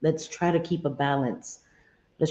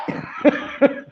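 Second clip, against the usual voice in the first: high breathy laugh; burst of laughter; 11 semitones higher, 3 dB louder than usual. Real, not acted. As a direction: laugh out loud at the joke. Laughs at own joke.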